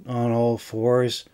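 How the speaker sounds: ordinary delivery, nothing marked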